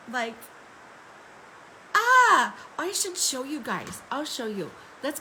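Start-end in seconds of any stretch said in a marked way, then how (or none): 1.9-2.5 loud, high-pitched "aah"; overwhelmed cry. Real, not acted. Exclaims over the big booth task.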